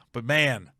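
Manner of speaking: normal talking voice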